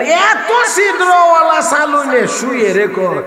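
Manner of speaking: high, drawn-out fable voice; taunting sieve. Acted. Sieve full of holes addresses needle, high taunting voice. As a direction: stretch it out high, mocking the needle.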